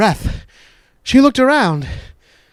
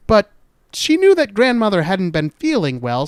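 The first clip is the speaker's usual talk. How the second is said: ordinary delivery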